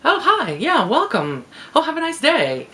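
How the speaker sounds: showing the high, polite voice she puts on for customers at work; polite professional voice. Shown as a demonstration. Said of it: "my polite professional voice".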